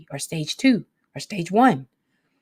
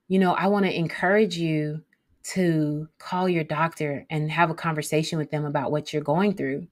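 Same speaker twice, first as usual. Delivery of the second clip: ordinary delivery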